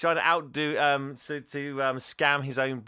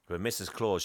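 normal talking voice

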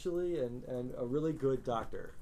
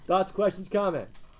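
calling out to class; 4 semitones higher, 8 dB louder than usual. Real, not acted. Calls out to the class.

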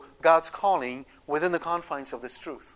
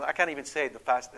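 ordinary delivery

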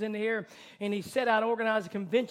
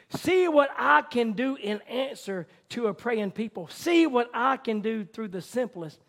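fervent preaching; 4 semitones higher, 9 dB louder than usual. Acted. Preaching God's words at full force.

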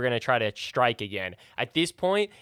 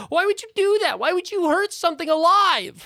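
high, loud hurt snake protesting; wounded snake cry; 5 dB louder than usual. Acted. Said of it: "gives out a scream as it says".

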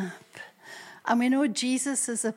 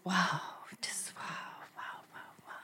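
whispered wow; 3 semitones lower, 14 dB quieter than usual. Shown as a demonstration. Quoted prayer fading to breath.

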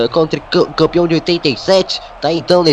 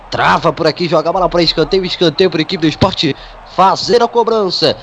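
ordinary talk, nothing marked

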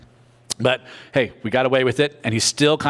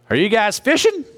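calling out across water; 9 semitones higher, 3 dB louder than usual. Retold or imitated. Jesus calling from shore.

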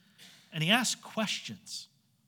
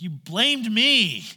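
loud, slow, higher, weighted words; icy accusation; 6 semitones higher, 10 dB louder, much slower than usual. Retold or imitated. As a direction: spell out each word to her husband. Eve's reply at dinner.

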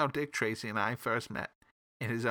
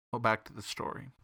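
ordinary delivery

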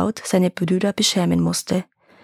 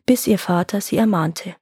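plain reading voice

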